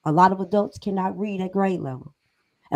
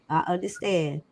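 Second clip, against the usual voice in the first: ordinary talk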